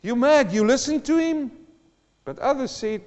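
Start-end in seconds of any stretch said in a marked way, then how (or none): 0.0-1.5 higher, loud ridiculing rant; mock incredulous jeer; mocking unbeliever. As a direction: scoff at the churchgoer in disbelief. Mocker voiced again.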